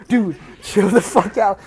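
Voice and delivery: slow, drawn-out friend's protest; amused telling-off. Retold or imitated. Friend with hands on his shoulders, amused pleading. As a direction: imitate a pal trying to calm someone down. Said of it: "He's laughing like crazy".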